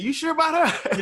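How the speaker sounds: mock-suspicious father; imitating dad.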